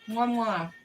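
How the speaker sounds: two loud, high "mwah" kiss sounds; blown kisses. Real, not acted. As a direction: blow two big smacking goodbye kisses. Blowing kisses when signing off.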